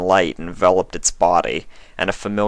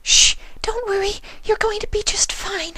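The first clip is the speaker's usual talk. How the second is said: high, breathy whisper of a timid pony; gentle, shy, barely daring to ask; Fluttershy's voice. A narrator's reading. Fluttershy reassures monster.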